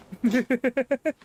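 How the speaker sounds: short rippling giggle. Real, not acted. Streamer admitting trouble with their mother tongue, giggling. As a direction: giggle self-mockingly.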